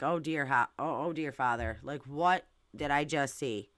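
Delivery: low, soft mock prayer; understated appalled disbelief; imitated dismay. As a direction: voice a stunned inner reaction, hushed and low. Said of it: "that face is like".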